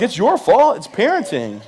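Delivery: high, loud hammed-up outrage; exaggerated indignant yell; mock angry rant. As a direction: snap back at the parents. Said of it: "I'm like".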